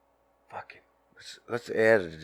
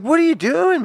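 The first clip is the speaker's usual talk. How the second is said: much higher, louder outraged cry; brother yelling; 8 dB louder than usual. Retold or imitated. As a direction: shout at him for going back to sleep. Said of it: "He said".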